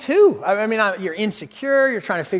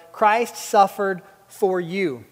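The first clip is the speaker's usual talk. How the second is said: slow, weighty reading of Christ suffered for you; slow emphatic reading; much slower than usual. Shown as a demonstration. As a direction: let each word land. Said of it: "makes it real personal".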